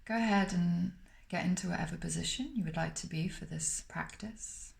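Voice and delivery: normal talking voice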